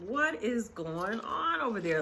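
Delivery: high, incredulous mock question; imitated puzzled disbelief. Other ladies wonder about the commotion, imitated puzzled disbelief. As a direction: mimic onlookers wondering, pitch lifted.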